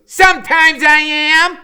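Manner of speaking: very high, loud, slow declaration; fierce booming answer. A narrator's reading. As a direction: stand tall and defy the teacher. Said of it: "still acting really fierce".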